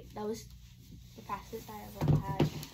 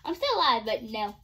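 ordinary delivery

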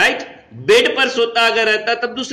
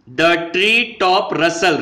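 slow dictation; much slower than usual. Shown as a demonstration. Teacher reads poem line.

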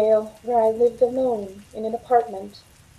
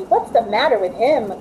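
usual speaking voice

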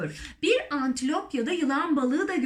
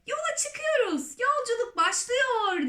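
very high, excited announcing cry; jubilant shout; 11 semitones higher than usual. A narrator's reading. Centipede announcing the journey, jubilant high cry. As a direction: cry it out high like a departure call. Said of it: "diye bağırdı kırk ayak".

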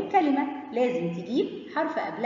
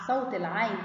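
usual speaking voice

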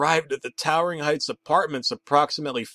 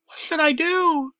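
much higher, louder panicked cry; screamed; 4 dB louder than usual. Acted. Narrator panicking at the caller, screaming into the receiver. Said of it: "I screamed into the receiver".

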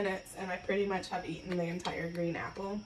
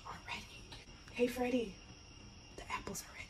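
hushed excited whisper; 4 semitones higher, 9 dB quieter, faster than usual. Real, not acted. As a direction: whisper it to a friend. Telling Freddy apples are ready.

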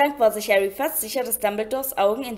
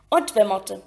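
ordinary delivery

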